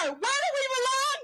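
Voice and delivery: shouted call to the crowd; rally call. Real, not acted. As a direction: throw the question out to the crowd. Call and response.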